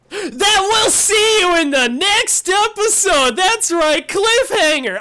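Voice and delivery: loud, high hype; big dramatic cliffhanger reveal; excited announcement. Real, not acted. Dramatic episode cliffhanger outro.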